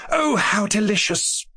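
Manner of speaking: high, thrilled woman's exclamation; delighted cry. A narrator's reading. A guest at the fireside, thrilled by the ghost story, cries out delighted. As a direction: gush with delight. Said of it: "cried one of the women".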